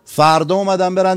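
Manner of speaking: ordinary delivery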